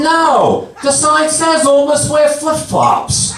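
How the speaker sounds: high, insistent comeback; exasperated retort. Acted. Answering the naked man in the sauna, exasperated.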